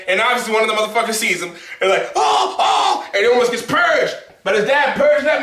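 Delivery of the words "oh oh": alarmed cry. Retold or imitated. Villain spotting the son.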